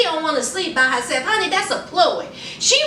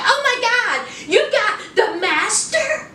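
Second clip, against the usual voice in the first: mock excited gasp; 6 semitones higher than usual. Darcy voiced excited over room.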